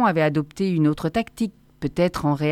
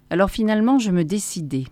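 plain reading voice

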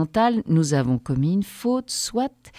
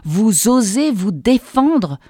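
slow, louder, outraged yell; shouting in her face; 4 semitones higher, 6 dB louder, slower than usual. A narrator's reading. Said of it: "me hurler en pleine figure".

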